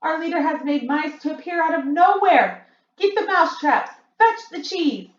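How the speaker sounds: high, loud excited crowd; witches clamouring. A narrator's reading. Witch crowd calls for mousetraps, high and loud. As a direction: call for traps in a frenzy.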